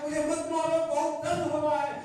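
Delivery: loud, high cry of pain, acted; hammed-up pained plea; pleading stone. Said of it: "फिर आवाज आया".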